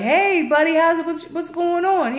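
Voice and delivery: put-on high, loud cheery hello from a little boy; lightly voiced kid greeting his dad's friend; chummy kid greeting. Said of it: "he was like".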